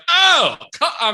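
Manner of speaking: sudden, high, loud yelp; startled gasp of disgust; surprised. Retold or imitated. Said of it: "I was just like".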